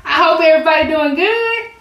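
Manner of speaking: high bright hello to Sam; sing-song hello; cheery greeting. Real, not acted. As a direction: lift the voice to greet him.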